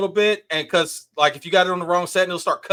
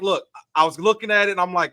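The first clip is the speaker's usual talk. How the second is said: ordinary talk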